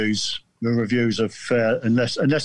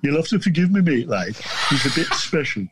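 put-on higher-pitched, rough go at a Geordie accent; attempted Geordie accent; 4 semitones higher than usual. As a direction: badly imitate a Geordie mate making excuses. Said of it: "I can't do the accent".

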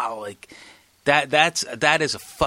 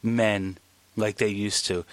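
solemn; 7 semitones lower, 8 dB quieter, slower than usual. Real, not acted. Solemn admiring remark.

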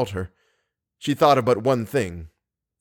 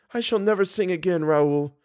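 higher-pitched, restrained woman's voice; sorrowful resolve; 7 semitones higher than usual. Acted. Singer telling Raoul she gives up, in sad resolve.

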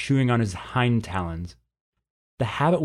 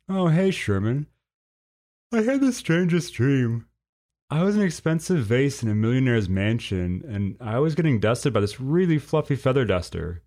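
lightly voiced drowsy young lizard, higher; a hint of a groggy teen telling a dream; sleepy Clive; 3 semitones higher than usual. Clive just woken, telling dream.

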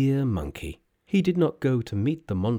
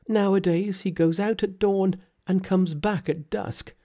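put-on weary young daughter, pitched up; frightened daughter; 8 semitones higher than usual. Daughter's voice in read story.

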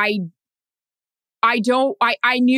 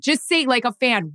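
usual speaking voice

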